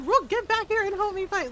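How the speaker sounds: high, loud comic pleading; mock panicked plea. Lucanus begging Rook to help fight, mock panicked plea. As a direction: demand help in hammed-up alarm.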